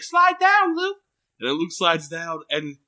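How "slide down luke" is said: sing-song mother. Retold or imitated. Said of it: "She's like".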